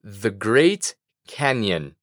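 I would like said very slowly for learners; slow model phrase. Shown as a demonstration. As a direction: spell out I would like slowly, word by word. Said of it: "Repite conmigo".

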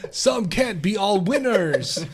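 slow, shouted quote with a laugh; game victory quote. Retold or imitated. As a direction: bellow it like a fighting game winner. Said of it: "is that a line from, like, Street Fighter?".

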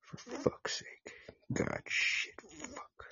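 slow curses breathed out, fading away; whispered cursing. Real, not acted. Host alone on mic, cursing in a fading whisper. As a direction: mutter in a whisper as your anger drains out. Frustrated swearing under breath.

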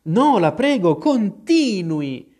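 imploring storybook Alice; very humble. A narrator's reading. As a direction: beg humbly, stretching the last word. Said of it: "disse Alice con grande umiltà".

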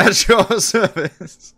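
slow, high, loud hammed reply; drawn out like a butler; slow grand servant voice.

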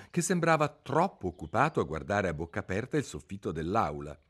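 plain reading voice

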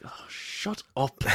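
slow, whispered imitation; hushed exasperation. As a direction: draw it out softly, almost voiceless. Quoting an exasperated character.

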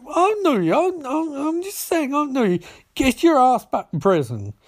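high-pitched mock protest; indignant. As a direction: act defiant, voice pushed way up. [name] quarrels in acted dialogue.

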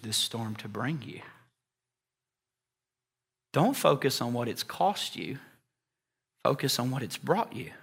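calm, patient voice of God; reassuring divine voice; God's gentle reply. Retold or imitated. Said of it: "how I think what God responds".

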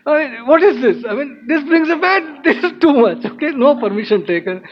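high-pitched, indignant mock tirade; upset ranting. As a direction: act out an exasperated rant, punching each complaint. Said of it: "very upset".

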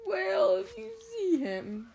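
high, slow, miserable whine. Acted. Tearful boy asks others to watch for toad, miserable. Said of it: "the boy said miserably".

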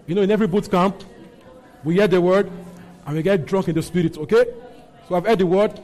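loud, driving charismatic exhortation; fervent preaching. Real, not acted. Exhorts congregation to pray in tongues.

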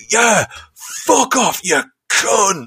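high hammed-up football heckle; mock heckler again. English dad retold heckling Booker T, mock insult. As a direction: sneer it like a fan on the terraces. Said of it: "it was just like".

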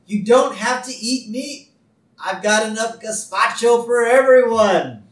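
high, girlish cartoon voice; high girlish Lisa impression. Voicing Lisa retelling episode.

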